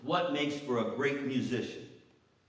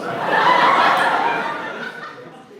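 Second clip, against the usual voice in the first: big burst of laughter, much louder; loud high laugh; 14 dB louder than usual. Real, not acted. Laughing hard at the pool story. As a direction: howl with laughter.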